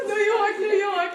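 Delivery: laughing, high, loud New York accent; slipping into New York accent. Real, not acted. Artist accidentally copying a questioner's New York accent. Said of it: "I almost copied your accent entirely".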